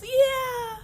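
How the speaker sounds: high loud eager yeah; excited yeah. Acted. Piggy agreeing with Elephant, high excited. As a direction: jump in eagerly. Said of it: "And Piggy goes".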